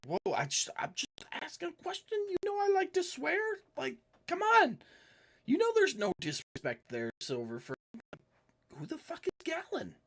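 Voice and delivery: high-pitched, flustered protest; indignant protest. Acted. Pinned character protests to attacker, high and defensive.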